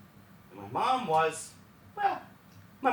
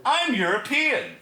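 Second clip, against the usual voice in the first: smug boast; 8 semitones higher, 6 dB louder than usual. Retold or imitated. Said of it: "he was like".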